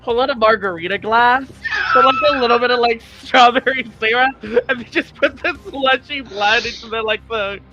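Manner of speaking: excited rant. Pretend. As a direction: build up the absurd picture with glee. Player imagines boss drinking blood.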